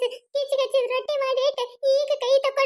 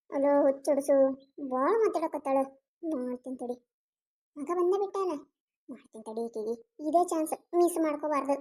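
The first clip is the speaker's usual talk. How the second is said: low, much softer plotting under the breath; scheming aside; 9 semitones lower, 7 dB quieter than usual. Acted. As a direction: mutter the plan to yourself, low and quiet. Mother-in-law scheming aside. (ಮೆಲ್ಲಗೆ ಗೊಣಗುವ ಕುತಂತ್ರದ ಧ್ವನಿ)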